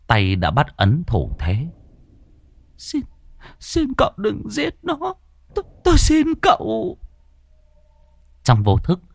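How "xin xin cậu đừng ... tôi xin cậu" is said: put-on high-pitched, faltering old mother's plea; desperate pleading. A narrator's reading. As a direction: beg for your son's life in a thin, high voice. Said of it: "cầu xin".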